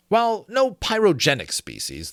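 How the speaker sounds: ordinary talk, nothing marked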